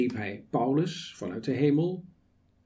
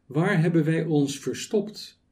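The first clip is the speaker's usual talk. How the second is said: ordinary talk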